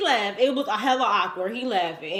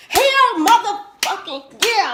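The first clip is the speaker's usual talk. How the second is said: high, loud mock outburst; a hammed-up shout of yes; emphatic inner yell; 10 semitones higher, 7 dB louder than usual. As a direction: blast it like you've finally had it. Thinking she wants him gone.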